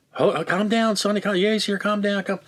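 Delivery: high-pitched pleading impression of a wife; put-on high voice, urging calm; imitated flustered wife. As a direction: imitate a wife trying to pacify a caller. Imitating wife pacifying screaming caller.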